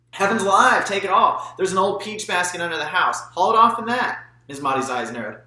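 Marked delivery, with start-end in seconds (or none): 0.1-4.2 put-on high, loud old lady exclaiming; exclamation. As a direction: throw up your hands and give it all away. Maudie exclaiming, generous.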